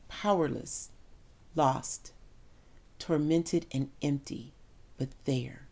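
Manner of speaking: soft, fading to almost nothing; broken grief. Acted. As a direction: let each word drain away. Mother at the cross, grieving.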